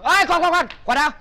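urgent call. Acted. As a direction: yell wait after her. Calling her back to pay.

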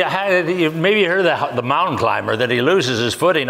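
normal talking voice

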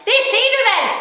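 high, loud shriek; desperate girl screams at the man; screaming. Acted. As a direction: scream it back at him. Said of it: "skrek hon".

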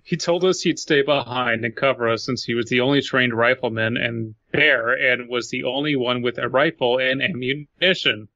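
ordinary delivery

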